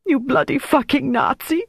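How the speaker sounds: high, tearful acted insult; sobbed. A narrator's reading. Greta in tears insulting Jelly, sobbed. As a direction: hurl it through tears, pitched high. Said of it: "she sobbed".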